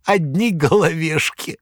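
high, theatrical storyteller voice; a buffoon spinning a breathless yarn, high; Koroviev's tale. A narrator's reading. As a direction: Koroviev dramatically retells the fire to his master.

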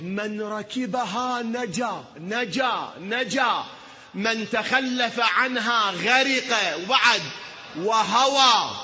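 high, loud, very slow chant of the hadith; fervent preaching. Acted. Preacher reciting the Ark of Noah hadith, fervent chant. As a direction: stretch every word like a pulpit lament.

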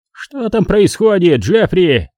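high, drawn-out mild shout; calling out. A narrator's reading. Mr. McCall calls from his house, calling out. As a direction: call across to the neighbour. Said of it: "услышал я крик мистера Маккола".

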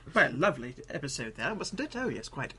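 soft, hammed-up English gentleman; understated mock-posh English accent; mock British accent. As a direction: parody polite British small talk, quietly. Joking parody of British chat.

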